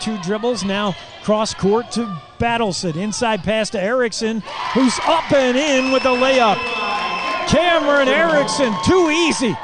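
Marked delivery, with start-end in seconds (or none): 4.7-9.6 higher and louder, calling the basket; radio announcer raising his voice on the score; excited call. Real, not acted. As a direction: sell the easy layup to the radio crowd.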